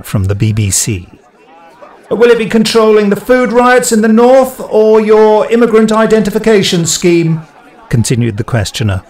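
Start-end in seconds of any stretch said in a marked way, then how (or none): 2.1-7.4 high, loud, hostile question; hostile shout from a crowd. Acted. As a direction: fire it out across the crowd. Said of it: "a hostile questioner".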